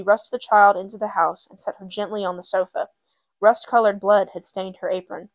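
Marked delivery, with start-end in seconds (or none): none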